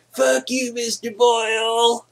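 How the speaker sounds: squeaky taunting class clown; bratty teen mock. Student heckling his teacher in class, high bratty taunt. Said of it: "I'm like".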